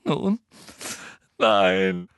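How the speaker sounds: a touch of sob, broken by a pause; sobbing. A narrator's reading. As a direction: Hermine faking tears. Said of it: "schluchzte Hermine". (leicht schluchzend)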